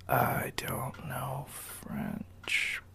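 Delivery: slow, hushed, drawn-out mock confession; sheepish admission. Someone asked to speak French admits they can't, sheepish. Imagined person caught out on French.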